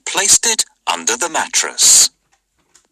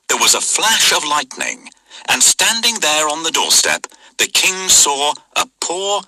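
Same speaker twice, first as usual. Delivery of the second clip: ordinary talk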